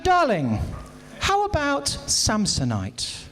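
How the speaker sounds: lightly voiced posh wife, slow; slow, affected wife asking; wife suggests a shop, mock posh. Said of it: "she said".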